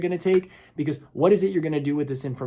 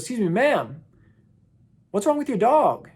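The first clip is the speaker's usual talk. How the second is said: higher-pitched polite call to someone; 8 semitones higher, 3 dB louder than usual. Retold or imitated. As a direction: call out across to a porch, mildly raised. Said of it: "he yells out to the woman".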